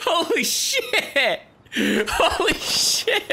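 high, drawn-out cry through laughter; laughing disbelief. Real, not acted. Player seeing the blood-covered horse, laughing disbelief. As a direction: stretch it out while cracking up.

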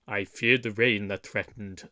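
low, sulky grumble; sullen. Acted. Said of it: "said Little John in a sullen tone".

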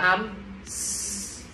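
S held as a long voiceless hiss. Shown as a demonstration. Teacher answers which final sound X has, S hissed long. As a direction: draw the S out into a soft sustained hiss. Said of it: "âm cuối cùng của nó là âm gì".

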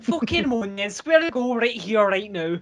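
put-on glasgow street accent. Mock street threats.

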